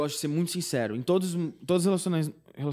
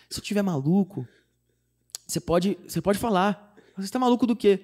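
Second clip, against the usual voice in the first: nervous asking; 7 semitones higher, 4 dB louder than usual. Retold or imitated. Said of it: "eu falei assim".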